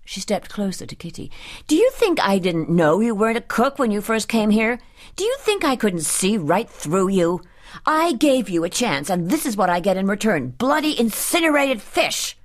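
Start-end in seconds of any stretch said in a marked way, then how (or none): 1.7-12.4 heated scolding, pitch climbing at the end; angry tirade. A narrator's reading. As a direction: berate the cook, building to the final insult. Furious employer berating the cook.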